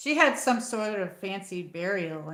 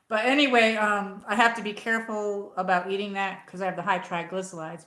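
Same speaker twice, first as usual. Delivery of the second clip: ordinary talk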